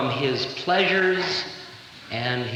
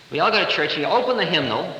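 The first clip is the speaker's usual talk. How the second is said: normal talking voice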